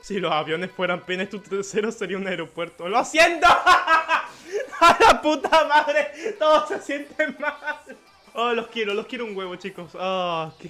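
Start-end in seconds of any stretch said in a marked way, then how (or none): 2.9-3.5 high, loud cry collapsing into laughter; shouted apology. Real, not acted. Shouted apology while cracking up. 3.5-4.8 high, loud cackle; real helpless laugh; loud laughter. Real, not acted. Laughs after apologising. 4.8-7.5 loud, high, slow drawn-out lament; mock-agonised, stretched cry; laughing wail. Real, not acted. Wailing through laughter over insult.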